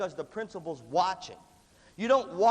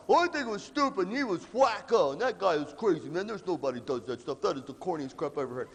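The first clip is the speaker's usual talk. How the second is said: put-on scoffing kid. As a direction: imitate a kid dismissing it all. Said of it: "So the kid that goes like".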